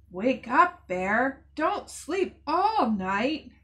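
sing-song wake-up call, loud. Acted. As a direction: call out to wake a sleeping bear. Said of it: "Little Owl sang".

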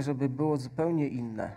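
ordinary delivery

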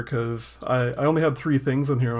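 normal talking voice

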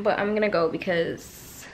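ordinary delivery

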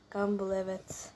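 normal talking voice

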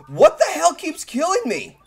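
ordinary talk, nothing marked

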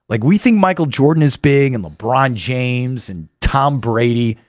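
ordinary delivery

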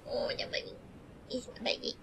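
breathy whispered coo. Real, not acted. Cuddling her dog, a whispered coo. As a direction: whisper sweet nothings to the dog.

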